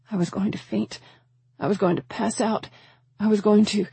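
mild panic, lifted and repetitive; rising panic. A narrator's reading. Mother feels herself about to faint, rising panic. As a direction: build toward breaking off mid-thought.

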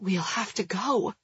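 softly voiced near-whisper. A narrator's reading. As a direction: keep it hushed, under your breath. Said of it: "Jules whispers".

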